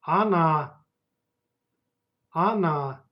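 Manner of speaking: Она with the weight on the final а; stress on the last vowel. Shown as a demonstration. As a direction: lean on the end of Она. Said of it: "Pay attention to the stress".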